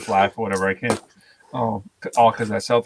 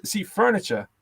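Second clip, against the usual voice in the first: furniture said as funichah, pitched up; Boston furniture with the Rs dropped; 8 semitones higher, 4 dB quieter, slower than usual. Shown as a demonstration. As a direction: drop the Rs in furniture. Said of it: "people trying to really overdo it".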